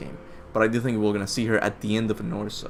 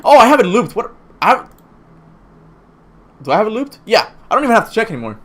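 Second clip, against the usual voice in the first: surprised; 11 semitones higher, 9 dB louder, faster than usual. Real, not acted. Realizing the music is looped.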